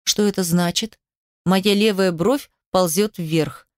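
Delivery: plain reading voice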